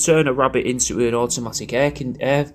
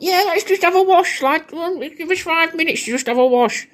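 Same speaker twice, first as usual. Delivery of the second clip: much higher put-on mum voice calling down the stairs. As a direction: camp up a mum's sing-song reply from upstairs. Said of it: "And you're shouting down, going".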